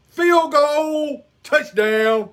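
ordinary delivery

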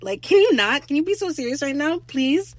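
high, loud mock scolding; exasperated plea. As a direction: beg someone to get serious. Exasperated plea to the messy character.

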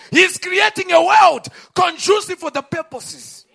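preacher crying out the prophet's words; preaching at full force. Real, not acted. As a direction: command the storm from the pulpit.

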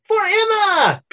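high, slow, alarmingly cheery chant. Acted. Bunnies greeting the party at the door, alarmingly cheery. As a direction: sing it out like creepy happy bunnies. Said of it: "in an alarmingly cheery voice go".

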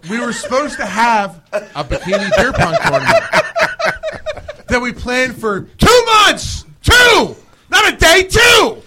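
building to a high, loud shout on two; indignant rant. Real, not acted. Host venting about the failed tournament, shouting. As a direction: hammer the two, near yelling. Ranting about wasted planning.

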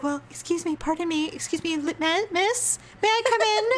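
high, tentative mock-polite voice; timid interrupting husband. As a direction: imitate someone meekly begging to come in.

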